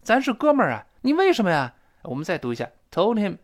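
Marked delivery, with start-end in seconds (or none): 0.0-1.7 high-pitched betrayed friend protesting; acted accusation, pitch jumping up; agitated, stressed reproach. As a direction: plead indignantly with a buddy. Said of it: "一定要重读了".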